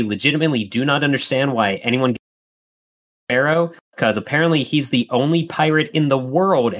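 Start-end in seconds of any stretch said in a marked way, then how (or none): none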